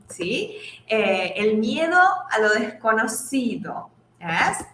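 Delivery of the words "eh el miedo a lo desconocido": ordinary talk, nothing marked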